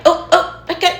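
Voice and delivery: high, loud, rushed mock urgency; silly, high-pitched fussing at a child; playful humor. Shown as a demonstration. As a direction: play it up fast to make a kid laugh. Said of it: "I like to, like, incorporate humor".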